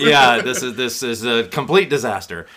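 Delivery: loud, high mock despair; mock dismay. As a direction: cry it's a disaster. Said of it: "I sat there saying".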